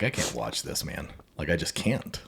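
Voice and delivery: low, hushed protest; quiet retold refusal. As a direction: say it softly, backing away from it. Retells his overwhelmed refusal to watch.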